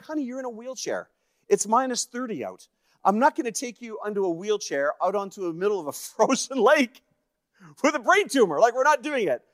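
high-pitched, rising exasperation; incredulous protest. Retold or imitated. Husband objects to frozen lake with sick wife, protesting high. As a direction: re-enact a husband talking his wife out of it.

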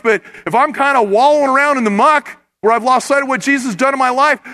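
normal talking voice

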